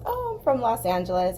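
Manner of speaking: ordinary delivery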